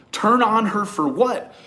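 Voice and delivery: slow, high, loud disbelief; incredulous. Retold or imitated. Retold incredulous reply to friend.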